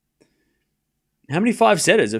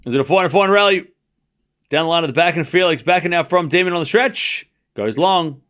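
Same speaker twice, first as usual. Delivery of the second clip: quick, higher rally commentary; play-by-play; 4 semitones higher than usual. Acted. Calling the live rally for listeners, play-by-play. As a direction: call the rally as it happens.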